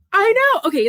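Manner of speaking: high, loud burst of agreement; excited exclamation. Real, not acted. Host answers cousin's comment on the heirloom, excited exclamation. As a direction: cry it out in delighted agreement.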